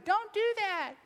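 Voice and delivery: put-on high childish complaint; a kid groaning at a dad joke; whiny kid protest. Son protesting to daddy, high and whiny.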